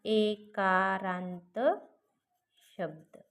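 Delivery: teacher's slow emphasis on the term; drawn-out term. Shown as a demonstration. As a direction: stretch एकारांत very slowly, syllable by syllable. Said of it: "हे काय झालं?".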